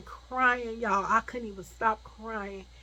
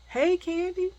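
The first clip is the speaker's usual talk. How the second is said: high, raised greeting; calling out; 10 semitones higher, 6 dB louder than usual. Retold or imitated. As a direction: hail her from down the street. Acquaintance calls out to her.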